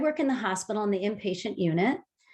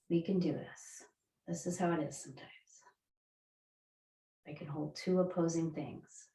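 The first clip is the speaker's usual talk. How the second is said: much softer, low soothing guidance; soft guided meditation; 3 semitones lower, 8 dB quieter than usual. Acted. Yoga teacher guiding the group through calming breaths, hushed and soothing. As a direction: ease them into calm with a hushed voice.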